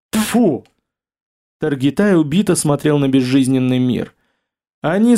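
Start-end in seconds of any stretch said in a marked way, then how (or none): 0.1-0.6 a sharp "tfu" spat out; disgusted spit. A narrator's reading. Oleg spits in disgust.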